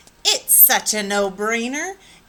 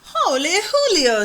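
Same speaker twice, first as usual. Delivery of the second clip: much higher and louder, thrilled squeal; 9 semitones higher, 7 dB louder than usual. Acted. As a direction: burst out in delight. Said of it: "squealed the kitty boy".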